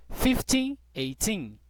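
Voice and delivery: slow, careful year reading; slow model. Shown as a demonstration. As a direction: split the year slowly. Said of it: "como é que eu falo?".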